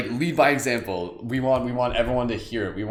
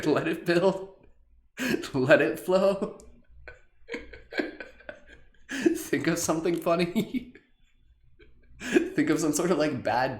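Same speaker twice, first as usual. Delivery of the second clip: laughing while guiding; 7 semitones higher than usual. Real, not acted. Laughing through instructions.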